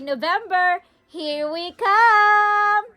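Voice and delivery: very high, slow drawn-out cheer; ecstatic. Real, not acted. Mom leading viewers in cheering for November. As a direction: stretch the last words in delight. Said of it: "Say, say".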